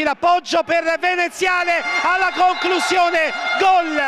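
shouted, high goal call; breathless italian goal commentary. Real, not acted. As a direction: erupt as the ball goes in. Goal scored, commentator erupts.

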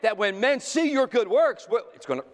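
high, loud preacher's fervor; rousing pulpit preaching. Acted. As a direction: preach it out at full force to the church. Preaching to the congregation.

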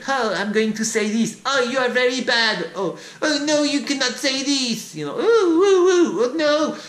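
very high, wailing mock voice; mock whining puppets. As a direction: ham up a whiny fake lament. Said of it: "acting like puppets".

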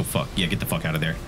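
ordinary delivery

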